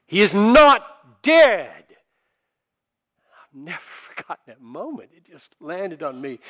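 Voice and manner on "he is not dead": loud, slow, high booming proclamation. Retold or imitated. Ethics teacher proclaiming at the funeral, booming and slow. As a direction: boom it out over the coffin. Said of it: "in his booming voice said".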